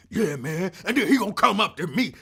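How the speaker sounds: loud, fast mock street-corner talk; lightly voiced loudmouth dude; loud storyteller on the corner. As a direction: imitate a guy broadcasting his story.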